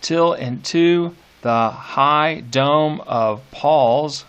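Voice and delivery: very slow, each beat weighed; slow scanned reading. Shown as a demonstration. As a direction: stretch the line to test its stresses. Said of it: "you gotta give it stress there".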